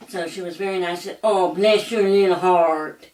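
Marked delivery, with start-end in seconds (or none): 1.2-3.1 slow, loud sweet Southern blessing; a sweet genteel Southern lady's loud, drawn-out blessing. Retold or imitated. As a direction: lay on the Southern sweetness slowly. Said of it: "your idea of a genteel Southern woman".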